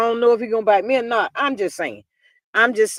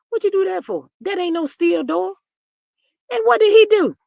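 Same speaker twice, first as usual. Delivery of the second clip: high-pitched scolding, building louder; incredulous mock scolding; 9 semitones higher, 4 dB louder than usual. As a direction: tell her off in disbelief, pitched way up. Scolds absent girl over locked door.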